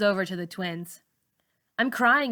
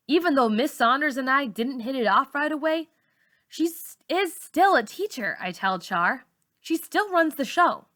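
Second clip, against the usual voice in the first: put-on defiant girl; 6 semitones higher than usual. A narrator's reading. Malika defends the teacher.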